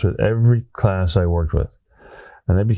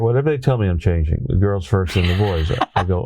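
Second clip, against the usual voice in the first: ordinary talk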